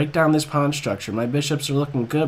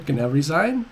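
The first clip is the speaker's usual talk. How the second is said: ordinary talk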